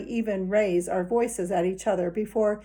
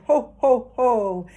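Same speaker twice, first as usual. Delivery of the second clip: loud, higher jolly ho ho ho; Santa Claus; 3 semitones higher, 7 dB louder, much slower than usual. Retold or imitated. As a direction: boom it out like Santa. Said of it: "He said".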